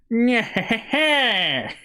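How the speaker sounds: high cartoon villain's cackle, falling at the end; villainous cackle. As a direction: gloat with an evil laugh. Game villain gloats after threat.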